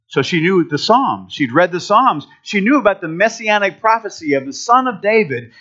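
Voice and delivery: ordinary talk, nothing marked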